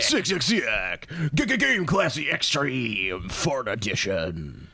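slow, stretched promo-voice with stuttered echo; mock hype announcer. Co-host spoofing an ad for a fart episode, hammed-up announcer. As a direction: sell it like an over-the-top advert.

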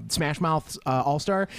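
normal talking voice

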